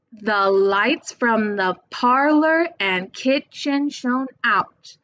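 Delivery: slow sing-song beat on LIGHTS, PARLOR, KITCHEN, OUT; poem line scanned slowly to show its beat; stressed syllables marked. Shown as a demonstration. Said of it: "figure out which syllables are being stressed".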